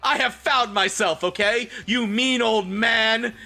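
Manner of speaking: high angry yell. Acted. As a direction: shout at the old man. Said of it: "Hagrid yelled".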